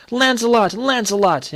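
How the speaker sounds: put-on high excited cry in a French accent; high-pitched French-accented yell, falling on each call. A narrator's reading. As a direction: cheer wildly like a Frenchman at the races. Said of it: "yelling ... in his French accent".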